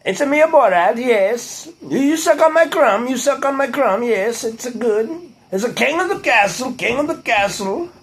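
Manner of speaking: high, sing-song foreign caricature; silly high-pitched comic foreigner; Borat impression. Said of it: "I used to do".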